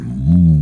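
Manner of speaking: low droning hum; imitated humming. Imitating the hum he heard in the monastery, low humming. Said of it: "I heard this humming".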